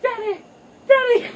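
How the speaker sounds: high, loud panicked yell; shrieking child's call for father; desperate cry for help. Retold or imitated. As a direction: scream for your dad while hanging. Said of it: "she's like".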